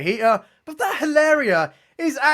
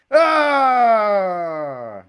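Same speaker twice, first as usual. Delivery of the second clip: short, loud, exasperated shout; frustrated yell; 4 dB louder than usual. Real, not acted. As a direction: let out your annoyance in one cry. Exasperated at the boring show.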